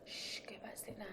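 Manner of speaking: soft whispered hush. Retold or imitated. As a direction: whisper it to settle a baby. Parent hushing baby at night.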